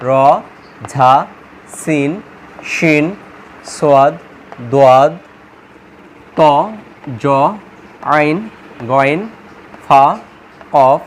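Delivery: each letter alone, long pauses between; careful letter recitation. Shown as a demonstration. Teacher repeating the letters for memorizing, careful spaced recitation. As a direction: model each Arabic letter slowly for repeating. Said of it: "সঠিক উচ্চারণে মুখস্থ করবেন".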